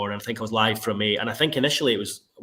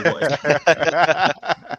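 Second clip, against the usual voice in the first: laughing disbelief; 3 semitones higher, 7 dB louder than usual. Real, not acted. Disbelief at emoji sign-off.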